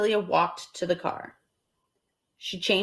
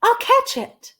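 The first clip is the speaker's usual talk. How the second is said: high, loud, eager; thrilled to spot a fish; excited Amelia Bedelia; 5 dB louder than usual. Acted. Amelia Bedelia spotting a fish, high and excited. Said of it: "she said".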